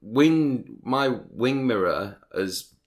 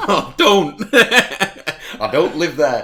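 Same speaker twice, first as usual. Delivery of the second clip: laughing protest; 6 semitones higher, 7 dB louder than usual. Real, not acted. Laughing at the postcode joke.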